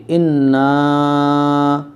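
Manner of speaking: slow, loud teaching recitation; Quran recitation. Shown as a demonstration. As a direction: recite loudly, holding the long vowels.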